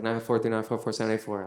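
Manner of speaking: quick-fire phone number; super fast. Retold or imitated. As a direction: rattle off the digits. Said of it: "I said it super fast".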